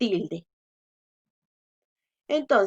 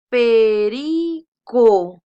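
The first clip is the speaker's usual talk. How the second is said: perico slow and loud, "pe-RI-co"; loud, drawn-out teacher's demo of perico; sounded-out syllables; 9 dB louder, much slower than usual. Shown as a demonstration. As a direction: stretch perico, punching "ri".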